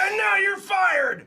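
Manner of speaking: slow, high, loud shout; furious firing. Acted. Boss firing his clerk Riley, furious shout. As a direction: spit out the firing.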